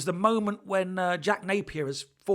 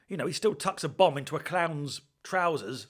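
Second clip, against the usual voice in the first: ordinary delivery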